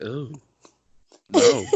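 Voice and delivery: mock horrified. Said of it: "I'm like".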